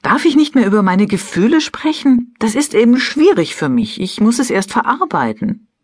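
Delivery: high-pitched, aggrieved self-defence; indignant protest. A narrator's reading. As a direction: defend yourself, pitched well up. Acted protest after interrupting her husband. (gekränkt protestierend)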